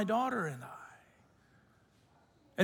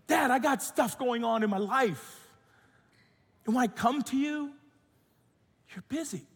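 higher, hurt daughter voice without real sobs; weeping daughter, imitated; 5 semitones higher than usual. Daughter confronts busy dad after weeping, mildly imitated upset. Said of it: "she starts weeping and weeping".

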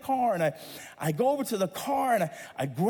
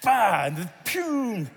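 ordinary talk

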